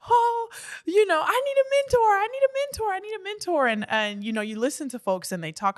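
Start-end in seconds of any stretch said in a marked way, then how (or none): 0.1-3.7 exaggerated high whiny plea; mock whining. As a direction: whine it like a pleading complainer. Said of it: "I'm always whining and saying".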